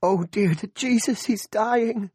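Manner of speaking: high slow anguished wail; wailing mother. A narrator's reading. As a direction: cry it out in terror. Mother sees son seizing.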